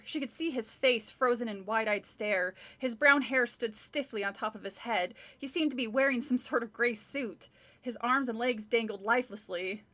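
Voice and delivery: plain reading voice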